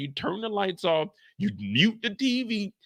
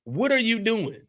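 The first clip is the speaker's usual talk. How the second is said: ordinary talk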